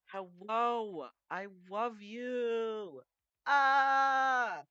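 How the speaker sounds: high, loud delighted shrieks; really excited. Real, not acted. Streamer greeting a favourite viewer, excited shrieking. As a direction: gasp and squeal at a friend showing up. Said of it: "Sorry, I got really excited".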